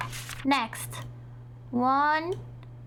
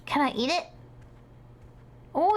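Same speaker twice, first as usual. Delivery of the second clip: ordinary talk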